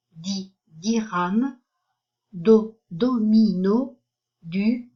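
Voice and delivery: each syllable like "ba" or "fu" said alone, then its word, like "futur", slowly and evenly; slow phonics drill, syllable "da" set apart before "dame"; syllable-by-syllable reading. Shown as a demonstration. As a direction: sound out "bi, biberon", "do, domino" slowly with gaps between the pieces. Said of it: "syllabes qui sont plus haut".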